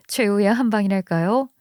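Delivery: usual speaking voice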